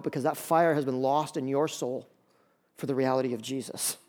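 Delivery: usual speaking voice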